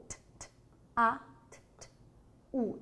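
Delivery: T, t, a, t, t, u sound by sound; teacher segmenting tatu. Shown as a demonstration. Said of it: "Olha lá".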